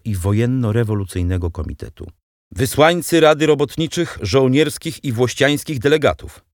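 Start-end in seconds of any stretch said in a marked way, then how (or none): 2.5-6.4 higher, louder formal proclamation; loud announcement. A narrator's reading. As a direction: announce the delegation to the hall. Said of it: "oznajmił robotnik". (głośna, wyższa zapowiedź)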